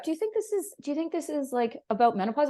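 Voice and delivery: high, tentative question in a put-on voice; lightly voiced partner tiptoeing around the subject; mock partner. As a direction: partner gingerly asking about menopause, put-on high voice. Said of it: "when my partner would bring it up".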